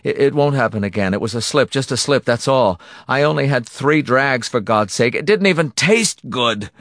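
high, loud, flustered excuses; panicky babbling. A narrator's reading. Husband excusing a slip to his tormentor, babbling. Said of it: "Morrison babbled".

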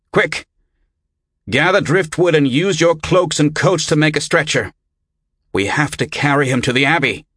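rally helpers with an urgent order; urgent command. Acted. Rescuer ordering men to carry a stranger to the Abbey, urgent command.

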